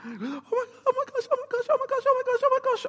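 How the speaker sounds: very high, squealing oh my gosh; excited Peter. Acted. Peter squealing at seeing Jesus, very high and excited. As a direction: gush like a starstruck fan. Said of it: "at this point gets really excited".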